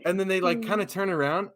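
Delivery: ordinary talk, nothing marked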